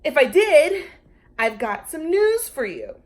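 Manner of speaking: loud, emphatic, lecturing; bossy. A narrator's reading. Lulu lecturing the Brontosaurus, loud and bossy. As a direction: lay down the law like a bossy kid. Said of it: "its old bossy self".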